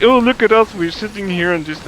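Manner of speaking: high, loud mocking whine; mocking other players. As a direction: mimic smug lazy players. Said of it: "they just like".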